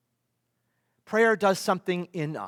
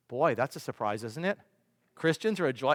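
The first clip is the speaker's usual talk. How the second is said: normal talking voice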